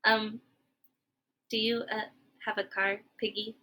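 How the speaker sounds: hesitant and very soft, trailing off. Acted. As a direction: ask sheepishly, almost under the breath. Gerald timidly asks Piggy.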